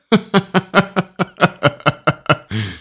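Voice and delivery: a big, high burst of laughter; real high laugh; hearty laugh. Real, not acted. Laughing at the reveal.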